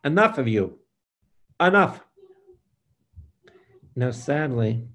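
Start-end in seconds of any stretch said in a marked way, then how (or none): 0.1-2.0 loud shout, the last "enough." high and loud; yelled rebuke. Shown as a demonstration. Preacher tells people to yell at their sin, a loud shout. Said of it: "you yell out and say".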